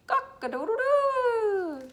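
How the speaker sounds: very high, loud, slow rooster crow. Shown as a demonstration. Reading rooster page to son.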